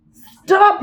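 sharp, high yelled stop. A narrator's reading. Bobby yells at the puppy grabbing Toothy, high and loud. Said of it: "he yelled".

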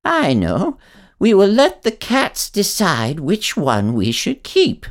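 put-on high, slow old woman voice; a granny with a plan. A narrator's reading. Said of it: "and then she said".

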